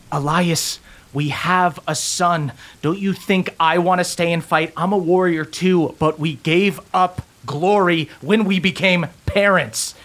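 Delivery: a mother begging her husband to stay; Lydia pleading. Acted. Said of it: "your mother, goes".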